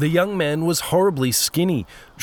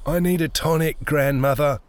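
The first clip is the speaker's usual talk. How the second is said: mildly whiny posh plea; whining. A narrator's reading. Young man begs grandmother for a tonic, whining. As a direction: whine for pity with affected refinement. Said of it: "he whined".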